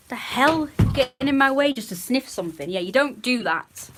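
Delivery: real irritation at the dog; annoyed at the dog. Real, not acted. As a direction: tell the dog off for getting underfoot. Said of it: "I'm yelling at the dog".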